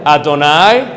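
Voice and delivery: loud call-and-response lead; call to repeat. Shown as a demonstration. Teacher calls the Shema out for the class to repeat. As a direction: call each word out for the class to echo. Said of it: "vamos a pronunciar".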